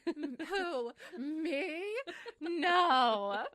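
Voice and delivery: high, loud hammed-up disbelief; mock dismay. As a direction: wail it in mock anguish through laughter. Hammed-up self-doubting hero act.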